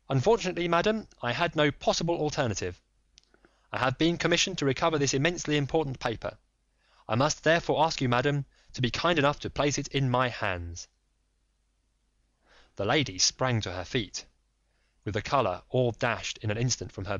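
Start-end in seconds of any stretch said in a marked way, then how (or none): 0.1-10.9 lightly voiced firm detective; polite but firm, a touch raised; Holmes. Holmes pressing the lady, lightly voiced firm.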